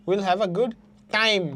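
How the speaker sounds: short pause, then a firm falling "time"; stress on TIME. Shown as a demonstration. Teacher showing students the stressed word, pause then stressed "time". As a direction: set "time" apart and stress it. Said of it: "টাইমে কিন্তু stress পড়তেছে".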